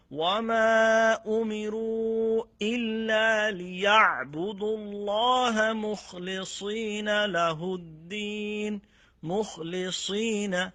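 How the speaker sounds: Quran recitation. Acted. Teacher reciting the verse for students, slow melodic chant. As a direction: chant it with long held vowels.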